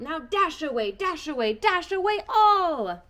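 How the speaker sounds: loud, high rising call to the reindeer; yelling. A narrator's reading.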